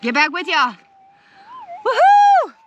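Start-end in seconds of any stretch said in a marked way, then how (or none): 1.8-2.5 high, loud woohoo; joyful whoop. Real, not acted. Prospector signs off to viewers, whooping. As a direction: cheer like you struck gold. Signing off happy after the find.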